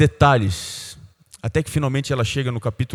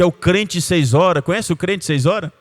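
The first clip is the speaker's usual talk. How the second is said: usual speaking voice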